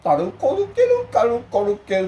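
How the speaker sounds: very high, stretched-out squeal of nonsense syllables; imitating grandchild's vocal drill. Retelling his grandchild's warm-up, squealing the syllables high. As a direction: squeak it like a child doing voice practice. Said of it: "孫がよく言ってたね".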